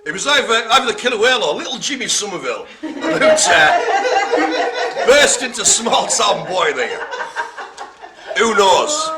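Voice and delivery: fast, giggling through the words; can't stop laughing as he tells it. Real, not acted. Host giggling after a whale impression, laughing. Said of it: "laughing through the story".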